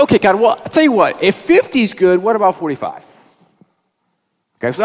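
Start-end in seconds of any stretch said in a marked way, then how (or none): 0.0-3.1 higher, louder wheedling bargainer; Abraham bargaining, raised and eager; pleading Abraham. Retold or imitated. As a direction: haggle with God like a market trader. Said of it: "And Abraham goes".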